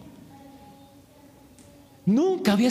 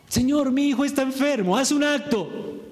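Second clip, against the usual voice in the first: higher, demanding request to the Lord; a noble still giving orders, asking for a miracle; official's first plea; 6 semitones higher than usual. Retold or imitated. Preacher voicing the official's first demand, pitched higher. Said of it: "¿Notan la transición?".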